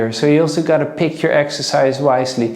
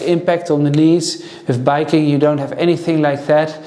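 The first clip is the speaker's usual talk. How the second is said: ordinary talk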